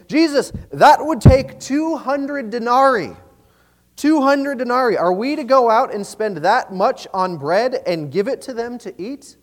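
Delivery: high, loud, incredulous objection; acted disbelief, pitch jumping up; confused protest. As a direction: balk at the huge cost, stressing the figure. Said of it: "very, uh, confused by this".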